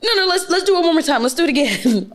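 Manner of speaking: high, fast begging for a rematch; sore loser pleading. Retold or imitated. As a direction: rush it like he can't stand losing. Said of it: "How is he when he loses?".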